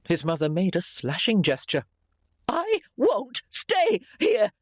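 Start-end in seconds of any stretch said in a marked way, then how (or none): 2.5-4.5 very high, drawn-out protest; agitated refusal. A narrator's reading. Ailing mother insisting on leaving, agitated. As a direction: insist slowly, word by word, pitched way up.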